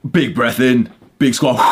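raging powerlifter psych-up. Retold or imitated. Coach imitating a powerlifter before the heavy bar, loud psych-up. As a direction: huff and pump yourself up for a lift. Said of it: "they're, like, raging, they're, they're being loud".

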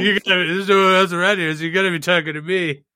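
hammed-up mirror tough guy; a put-on movie tough guy voice; Taxi Driver impression. Trump miming Taxi Driver scene.

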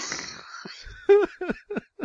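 high, wheezy burst of laughter; helpless laugh. Real, not acted.

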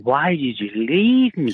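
drawn-out, swooping lovelorn cry; hammed-up, stretched-out anguish; mock heartbroken wail. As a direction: wail it slowly like a dumped bloke tripping out. Acting out a jilted man's wail.

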